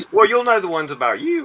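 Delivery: high, loud, amused tease; real laughing. Real, not acted. Teasing a friend about questions on them, laughing.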